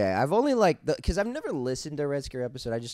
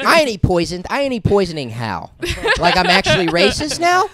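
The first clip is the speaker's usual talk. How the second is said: loud high mock outrage; mock indignation; 9 semitones higher, 10 dB louder than usual. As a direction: act offended, hammed up.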